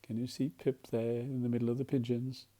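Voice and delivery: ordinary delivery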